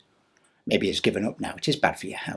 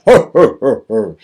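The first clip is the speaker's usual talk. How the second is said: Santa laugh; 2 semitones higher, 14 dB louder, slower than usual. Acted.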